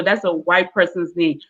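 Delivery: ordinary talk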